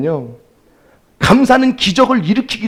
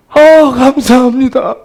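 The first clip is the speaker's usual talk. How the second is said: louder, heartfelt thanks; grateful relief; 5 semitones higher, 5 dB louder than usual. Retold or imitated. Parent thanking the ICU doctor for the baby's breathing, relieved.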